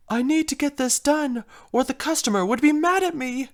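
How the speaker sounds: a touch of theatrical worry; anxious fretting. Acted. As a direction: fret over the deadline. Drama queen frets over a deadline.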